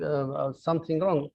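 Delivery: normal talking voice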